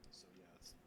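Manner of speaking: breathy half-voiced whisper. Real, not acted. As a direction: drop to a whisper. Quiet aside to the group.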